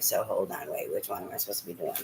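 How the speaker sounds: soft, fast, muttered confusion; confused mutter. Retold or imitated. Reader muttering, confused about which sign. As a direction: mumble it, lost and rushed. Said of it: "and then it's like".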